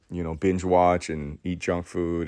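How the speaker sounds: normal talking voice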